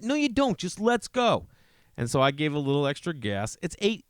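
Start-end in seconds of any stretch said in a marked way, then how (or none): 0.0-1.5 exasperated. Retold or imitated. Dad's retold hurried reply, high.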